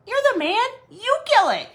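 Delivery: high, loud indignant protest; hammed-up exasperated insistence; retold squabble. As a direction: argue back in mock outrage. Acted spouse quarrel over spider.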